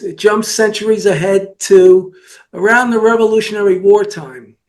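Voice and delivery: ordinary talk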